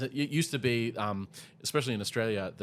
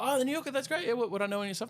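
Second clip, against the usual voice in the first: high-pitched imitation of polite interest; impressed stranger; 12 semitones higher, faster than usual. Stranger hearing about the New Yorker, impressed and high-pitched. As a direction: mimic someone impressed, voice pitched well up.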